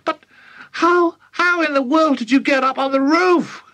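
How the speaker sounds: high, flustered mother; bewildered high wail; astonished cry. A narrator's reading. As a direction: cry out in disbelief, pitch high. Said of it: "cried Mother".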